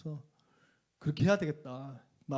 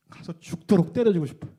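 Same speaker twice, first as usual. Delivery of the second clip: angry outburst; 8 dB louder than usual. Real, not acted. Pastor to congregation, furious at his past self, loud. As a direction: hit 때려주고 hard, loud and falling.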